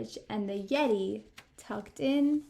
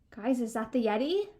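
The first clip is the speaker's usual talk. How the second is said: plain reading voice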